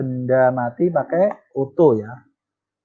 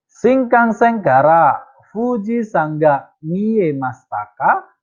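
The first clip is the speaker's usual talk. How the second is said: slow, clear Japanese model sentence, higher; slow model reading; 6 semitones higher, slower than usual. Shown as a demonstration. Teacher models a Japanese sentence slowly for the class. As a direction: read it out slowly for students.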